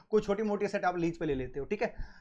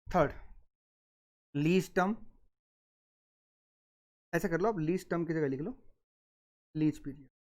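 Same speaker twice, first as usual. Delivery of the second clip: dictating. Shown as a demonstration. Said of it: "अगर आप लिखना चाहो तो सर यहाँ पे लिख लो".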